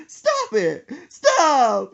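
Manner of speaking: loud, high panicked yell, acted out; screaming in fright. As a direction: shriek it like a spooked friend. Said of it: "And screams and goes".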